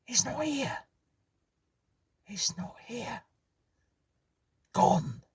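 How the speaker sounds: very high, shocked cry, then a whisper; horrified astonishment. Acted. Jackson finding the room empty, horrified. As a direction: gasp it out in horror. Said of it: "voiced his horrified astonishment".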